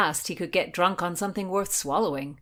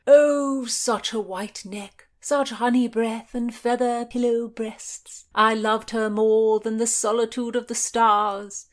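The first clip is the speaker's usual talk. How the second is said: higher, louder, swooning over a lost love; gushing old knight; 6 semitones higher, 8 dB louder than usual. A narrator's reading. Old knight to his companions, louder swooning over a lost lady. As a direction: sigh out the memory like a besotted old knight.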